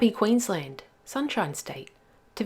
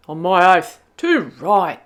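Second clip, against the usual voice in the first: cattleman voice; 7 dB louder than usual. Acted. Cattleman voiced in rhyme story.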